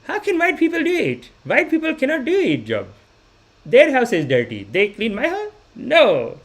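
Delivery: high-pitched, indignant Indian mother; Indian mom impression. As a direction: mimic a dismissive Indian mom, pitch swooping up. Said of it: "my mom's like".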